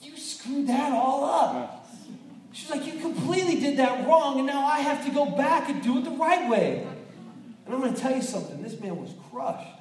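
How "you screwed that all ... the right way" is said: high mock scolding wife voice; mock nagging wife, pitched high. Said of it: "She was like".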